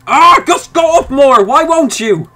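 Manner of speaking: high, loud pleading shout; exasperated yell. Real, not acted. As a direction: beg the piece to move, fed up. Pleading with the game piece.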